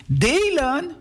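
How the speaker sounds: high rising "Delen?"; indignant surprise. Acted. Girl told to share her pear, indignantly surprised. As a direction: yelp the word as if outrageous. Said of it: "roept Inge".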